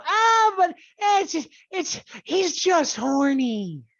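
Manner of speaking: high, mocking whine of a fan; sneering mock fan excuse. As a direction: mimic the excuse-makers with a sneer.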